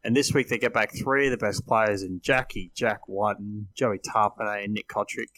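ordinary delivery

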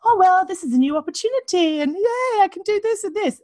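wife mocking upbeat self-help talk in a high, bright voice; mock forced positivity. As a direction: chirp it like a fake pep talk. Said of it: "not to kind of go".

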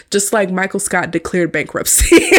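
ordinary delivery

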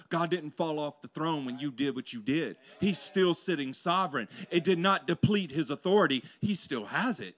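loud, building preacher's cry; preaching at full force. Acted. Preacher urging every listener, loud and rising. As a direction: push it up to a shout.

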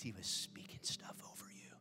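soft breathy sermon whisper; hushed whisper. Real, not acted. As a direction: lean in and whisper it.

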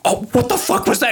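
retold startled cry, pitched high; high, panicky imitation of a startled girl; alarmed. Girl reacts to a scream in the dark, alarmed.

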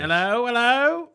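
rising mock-British greeting; British-leaning accent. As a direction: call it out high in a put-on British accent. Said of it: "That's more British".

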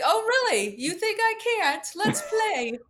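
high, laughing dare at doubters; mock taunting challenge. Guest mocking her doubters on a podcast, high laughing taunt. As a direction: needle them like you relish the fight. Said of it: "They're like".

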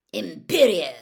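loud incantation; Moody casting the curse on Harry, loud; casting a spell. Acted.

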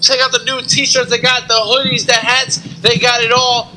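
normal talking voice

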